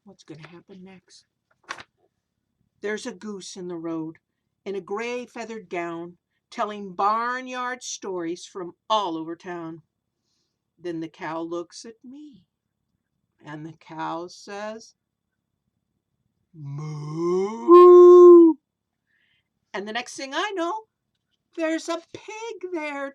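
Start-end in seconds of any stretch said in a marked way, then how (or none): 2.8-14.9 sing-song rhyming read-aloud, slow in places; storybook verse reading; reading to children. A narrator's reading. Reads rhyming picture book aloud. 16.5-18.5 big booming mooing cow, much louder; a cow's loud moo for the kids. Acted. Cow speaks in the story.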